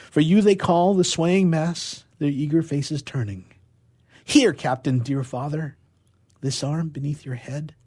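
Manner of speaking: ordinary talk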